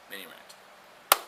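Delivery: under his breath. Real, not acted. Vlogger to himself after a digression, under his breath. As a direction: repeat it quietly, trailing into near-whisper.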